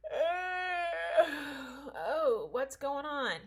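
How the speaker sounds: mildly voiced relaxed, just-woken drawl; chill Jesus. Jesus waking calmly amid panic, mildly relaxed. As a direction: lightly play him unbothered by the storm. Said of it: "so cool and chill".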